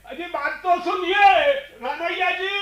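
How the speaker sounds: high, pleading call, fading into the distance; calling after someone. Acted. Host calls after the departing guest, pleading. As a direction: call him back urgently, pitched high.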